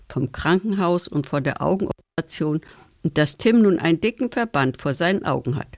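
plain reading voice

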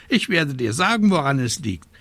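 high, scolding raven voice; croaking raven. A narrator's reading. Raven telling the witch she is scatterbrained, scolding high. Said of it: "krächzte der Rabe Abraxas".

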